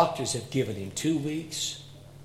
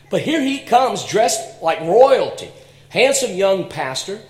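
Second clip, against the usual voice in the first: marvelling; 5 semitones higher, 7 dB louder than usual. Acted. Marvelling at the minister.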